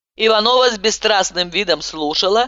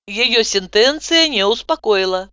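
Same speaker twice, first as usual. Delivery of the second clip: usual speaking voice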